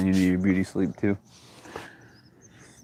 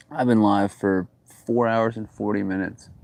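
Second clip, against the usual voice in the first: ordinary delivery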